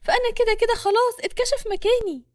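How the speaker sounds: high, anxious female voice; despairing worry, pitched well up; fearful. A narrator's reading. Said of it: "نور بخوف". (بخوف وبصوت عالي النبرة)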